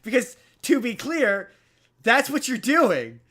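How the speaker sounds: mock-furious accusation; indignant rant. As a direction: pile on the outrage, cheat punched. Ranting at the angel's rule.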